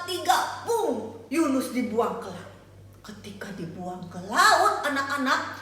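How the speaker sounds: ordinary talk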